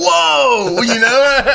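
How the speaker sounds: mock awestruck whoa, high and loud; hammed-up wonder; awed whoa. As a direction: gasp it out in amazement. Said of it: "they're all standing around like".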